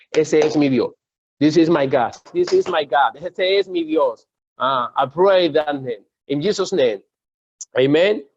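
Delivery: loud, slow, emphatic preaching; forceful declaration. Real, not acted. Preacher leading the church to declare their God, forceful. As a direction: proclaim it for the church to repeat.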